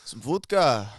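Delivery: mock Russian accent. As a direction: play a cartoon Russian. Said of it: "Can you do, like, a Russian accent?".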